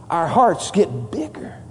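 slow hushed whisper. Acted. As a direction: breathe the words out slowly. Preacher drops voice for emphasis.

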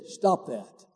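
soft, low firm stop; quiet self-command. Retold or imitated. Man quitting the news, soft firm self-command. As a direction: quietly cut yourself off. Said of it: "I made a decision".

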